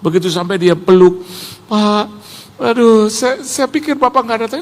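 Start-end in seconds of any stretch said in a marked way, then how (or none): 1.7-4.6 raised, halting, overwhelmed voice; mock being choked up with emotion; imitated tearful greeting. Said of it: "Terus dia nangis". (meniru sapaan haru hampir menangis)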